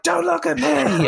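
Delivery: the queen shrieking at the boy in mock tantrum. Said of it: "she's like".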